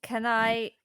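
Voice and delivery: ordinary talk, nothing marked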